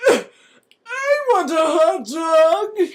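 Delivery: slow, loud demanding yell; cranky old guy. Acted. Random old guy demanding a hot dog, slow and loud. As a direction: whine it out like a grumpy old man.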